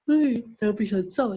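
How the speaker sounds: talking through a yawn. Real, not acted. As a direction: yawn while speaking. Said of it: "desculpa pelo, pelo bocejo".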